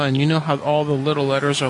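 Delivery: ordinary delivery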